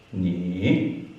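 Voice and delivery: "Ni" dipping then rising; third tone. Shown as a demonstration. Teacher drilling third tone, "Ni" down then up. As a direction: take "Ni" down and back up. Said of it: "going down and up".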